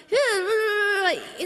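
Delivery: high yeahs breaking into laughter; old Italian neighbour's yeahs. Retold or imitated. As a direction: mimic the neighbour over the fence, high and laughing. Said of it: "in Italian".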